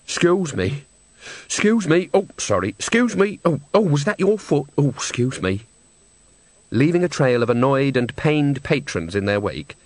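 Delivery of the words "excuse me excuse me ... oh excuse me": lightly voiced busy old woman squeezing past; a string of quick sorry-excuse-mes; old woman pushing along a row, lightly apologetic; apologetic shuffling. A narrator's reading. Nanny pushing past seated patrons.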